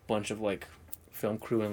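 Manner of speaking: ordinary delivery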